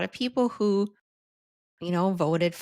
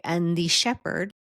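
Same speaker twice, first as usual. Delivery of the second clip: ordinary talk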